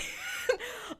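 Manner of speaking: high, light laugh; laughter. Real, not acted. Podcaster amused by the ongoing clown gag, laughing.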